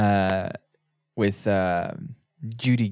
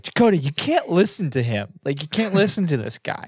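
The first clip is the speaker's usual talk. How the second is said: raised, pleading mock rant; B'Elanna losing it; 7 semitones higher than usual. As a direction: mimic her pushing hard for her plan. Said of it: "loses it".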